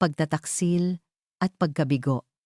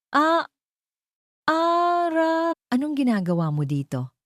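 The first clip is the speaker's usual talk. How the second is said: startled; 12 semitones higher than usual. Acted. Aunt caught hiding money.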